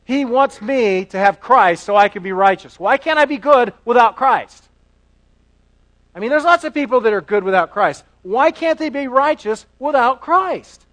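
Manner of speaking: indignant skeptic. Acted. Preacher voices a skeptic objecting.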